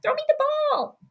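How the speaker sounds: high, eager, pleading call for the ball; excited receiver. Retold or imitated. Streamer voices Justin Jefferson begging teammates for the ball, high and eager. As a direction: beg the quarterback to throw it to you. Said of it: "He's like".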